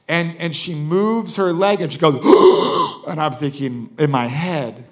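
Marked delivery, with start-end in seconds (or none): 2.2-3.0 sharp, loud imitated gasp; loud gasp, acted out. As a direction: mimic a sudden startled intake of breath. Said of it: "like, a gasp of pain".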